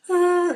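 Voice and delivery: high strained groan; pained groan. Retold or imitated. Groaning at boyfriend from cramps. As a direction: groan out in pain.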